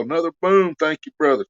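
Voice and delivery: ordinary talk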